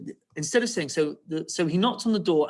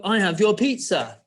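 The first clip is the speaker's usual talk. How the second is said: loud, raised-pitch wolf demand; firm, loud and higher; snapped; 5 semitones higher, 6 dB louder than usual. Acted. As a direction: read it back with a cross snap. Reading back the wolf's snapped line.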